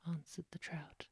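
plain reading voice

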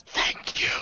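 voiceless, very soft whisper; mouthed thanks. Acted. Priest secretly thanking his crewmate, mouthed whisper. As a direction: barely whisper it, almost only mouthed. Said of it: "I'll mouth".

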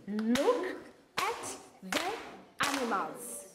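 each word slow and separate, clapped out. Shown as a demonstration. Teacher to class, clapping out each word slowly. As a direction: beat out every word to a clap. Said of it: "Let's do it again".

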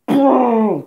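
loud, high mock wail; imitated crying burst. Teller re-enacting crying at the movie, loud wail. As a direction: burst out in one sudden bawl, acting it out. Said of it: "that's what made me cry. I was like".

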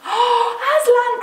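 very high, loud exclamation; children crying out in shock and joy; astonished cry. A narrator's reading. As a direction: shriek the name in amazement. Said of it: "cried both the children".

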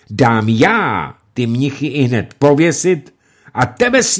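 loud, high angry tirade; furious nobleman ranting; raging. Acted. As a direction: rant at the absent upstart. Lumey raging at the pass.

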